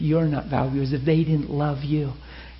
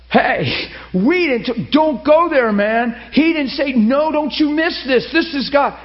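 high, loud protesting shout; retold shout; 10 semitones higher, 7 dB louder, slower than usual. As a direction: cut in hard, loud and high, to stop him. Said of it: "And Randy goes".

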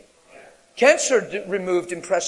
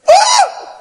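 much higher, shouted fake fright; a laughing, shrieking whoa; mock startled whoa; 11 dB louder than usual. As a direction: yelp like a scared believer. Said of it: "he likes when we go".

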